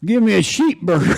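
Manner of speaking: SHEEP burger, high and drawled; mock country order; country mispronunciation. Wife ordering at the Sheetz counter, in a high mock country voice. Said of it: "so she said".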